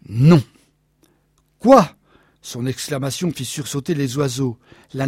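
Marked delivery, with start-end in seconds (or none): 0.1-0.4 a flat, loud refusal; firm loud no. A narrator's reading. Wife refusing her husband, loud and firm. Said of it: "puis répliqua". 1.6-1.9 high, loud shocked cry; shocked shout. A narrator's reading. Husband stunned by wife's refusal, shouting.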